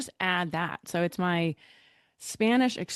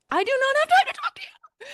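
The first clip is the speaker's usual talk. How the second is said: impatient inner voice; 5 dB louder, faster than usual. Retold or imitated. Inner voice, rushing to leave.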